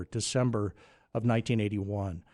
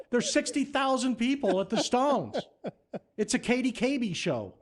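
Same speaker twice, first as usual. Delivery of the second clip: panicked protest; 12 semitones higher than usual. Retold or imitated. Teenage intern sent to face a stadium crowd, panicked protest. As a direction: wail it, pleading your youth.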